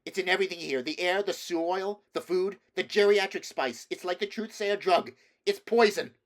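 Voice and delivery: a son warning of poison; feverish rant. A narrator's reading. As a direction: build to a frantic peak. Boy raving about spice.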